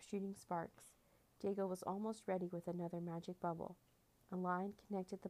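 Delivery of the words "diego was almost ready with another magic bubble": plain reading voice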